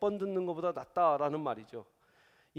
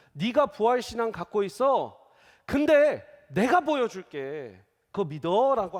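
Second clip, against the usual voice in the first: Jesus voiced to Martha; 3 semitones higher, 9 dB louder than usual.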